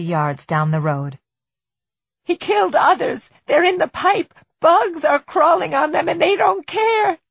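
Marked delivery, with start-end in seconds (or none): 2.2-7.2 very high, loud hysterical cry; frantic outburst. Acted. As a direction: cry out in rising horror. Victim's frantic outburst about killings.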